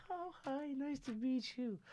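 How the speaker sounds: high, slow, sugary greeting; mock sweet grandmother. Grandson mimics grandmother greeting a guest, mock sweet. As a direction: imitate grandma's put-on politeness, high up. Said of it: "my grandmother's like".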